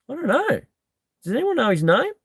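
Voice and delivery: high, mock-puzzled voices; high mock bewilderment; mock clueless mates. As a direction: play the mates all shrugging, pitched well up. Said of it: "everyone looks at everyone and goes".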